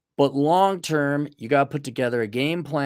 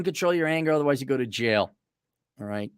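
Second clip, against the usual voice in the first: normal talking voice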